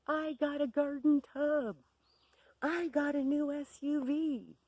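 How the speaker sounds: high, loud frantic boasts, gasping between; hyperventilating screaming. Retold or imitated. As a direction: mimic a screamer who can't catch breath. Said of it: "she starts screaming".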